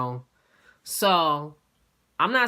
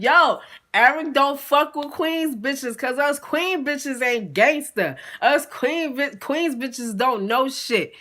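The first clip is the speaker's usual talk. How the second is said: high, slow sarcastic mocking; sarcastic taunt; 6 semitones higher, slower than usual. Teller mocking Eric with slow, high sarcasm. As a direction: drag it out to mock him. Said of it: "And I'm like".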